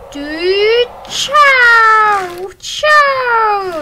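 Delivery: high, loud drawn-out chow; gleeful high yelping; excited yelps. Real, not acted. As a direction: cheer the crash loudly. Reacting to a big crash.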